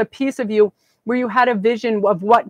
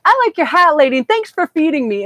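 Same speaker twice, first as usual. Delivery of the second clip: higher, louder, lightly voiced grateful stranger; a hint of a cheerful homeless guy, raised and bright; homeless man's thanks; 7 semitones higher, 4 dB louder than usual.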